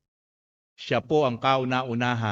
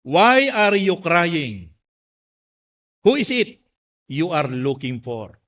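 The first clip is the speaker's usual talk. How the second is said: slow, loud ringing question; drawn-out preacher's quotation, starting high; proclaimed scripture quote; 5 semitones higher, 7 dB louder, slower than usual. Acted. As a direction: declaim the question slowly to the whole church. Slowly proclaims Jesus' quoted words.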